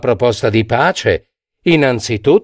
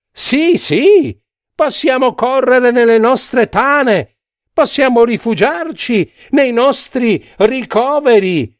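crowd cry; 11 semitones higher than usual. A narrator's reading. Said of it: "Un grido si levò dalle ultime file".

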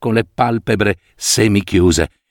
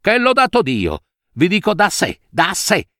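furious; 7 semitones higher than usual. A narrator's reading. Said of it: "grinta da cane idrofobo".

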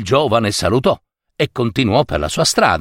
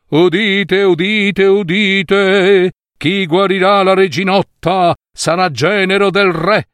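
loud high proclamation to the crowd; town crier; 8 semitones higher, 3 dB louder than usual. A narrator's reading. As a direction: announce it like a herald in the square. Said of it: "i banditori partirono".

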